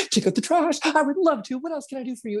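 high, bright eager yes; mock overeager new lover. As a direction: jump at the chance to help. Eager new partner voiced.

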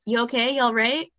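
light British "You all right?" with a lifted tune; imitating London locals asking "You all right?"; British greeting. Imitating a London greeting, mildly British. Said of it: "I was like".

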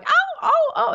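high yelped ow ow ow, half laughing; imitating yelping dog. Retelling her dog's yelps in a high laughing voice. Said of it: "he's going".

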